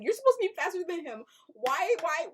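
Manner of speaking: loud, high, rushed complaint; exasperated scolding. Retold or imitated. Viewer lecturing the boy who should outrun his dad, exasperated scolding. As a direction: rattle it off, then plead why. Scolding the kid for not running.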